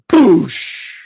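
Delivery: loud explosive "boosh" crash noise; imitated crash. Storyteller mimicking the car hitting a Prius, explosive boom. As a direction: burst out a crash sound effect.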